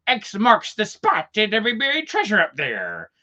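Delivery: high, put-on pirate boast; hammed-up pirate proclamation. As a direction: sell the treasure hunt like a pirate. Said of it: "he's like".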